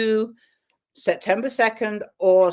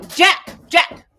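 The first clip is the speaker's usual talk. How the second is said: sharp high loud call of the name; yell at dog; 11 semitones higher, 10 dB louder than usual. Real, not acted. To Jack going nuts, real high loud yell. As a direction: yell the dog's name to stop him. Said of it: "Sorry, I didn't mean to yell at you".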